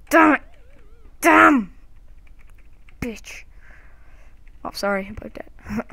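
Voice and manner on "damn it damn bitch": loud, high frustrated curse; angry. Real, not acted. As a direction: yell in frustration. Caught by ghost.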